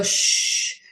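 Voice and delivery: long unvoiced shushing sound; hushing shh. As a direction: shush them, imitating a family's hush. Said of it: "everything was hush".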